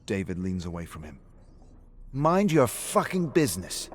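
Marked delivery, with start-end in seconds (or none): 2.1-3.9 higher, louder furious warning; angry snap. Acted. Drunk David telling Rick to back off, angry and loud. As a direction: shut him down hard.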